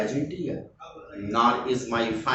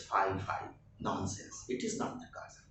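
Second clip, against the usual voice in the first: unvoiced whisper, much softer; whispered reading; 6 semitones lower, 14 dB quieter than usual. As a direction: breathe the line out as a whisper. Teacher reads Antonio's line.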